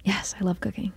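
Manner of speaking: breathy whispered yes. Real, not acted. Answering the host about cooking, whispered. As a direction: hush it as a playful aside.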